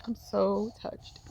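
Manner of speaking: slow, choked-up emphasis; slow and emotional, retelling being touched; moved to tears. Retold or imitated. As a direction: draw it out, overcome with gratitude. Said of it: "I'm crying, right? 'Cause I'm so touched".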